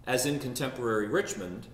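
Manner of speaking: ordinary talk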